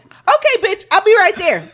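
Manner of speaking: high, eager reenacted reply; bestie on phone. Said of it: "let's reenact it".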